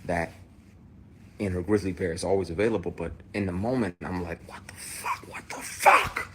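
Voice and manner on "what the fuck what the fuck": stunned whisper. Retold or imitated. Retold stunned inner reaction.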